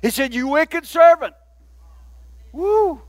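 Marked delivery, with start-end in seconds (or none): 0.3-1.3 loud, high dramatized rebuke; raised, forceful acted accusation; angry master. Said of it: "in anger, his master". 2.5-3.0 high "woo" at the rebuke; high whoop of mock alarm; impressed whoop. Real, not acted. Reacts to the master's rebuke.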